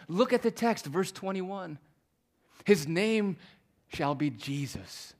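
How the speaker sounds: loud, high, pounding delivery; fiery pulpit proclamation; preaching at full force. Real, not acted. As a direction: thunder the point home.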